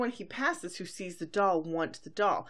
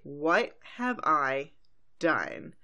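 slow, dropping mock-horrified delivery; Twilight; 3 semitones lower, much slower than usual. Pony watching the chaos she caused, slow mock horror. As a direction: drag it out, sinking low on the last word. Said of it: "Twilight is like".

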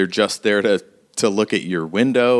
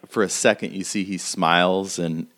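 ordinary talk

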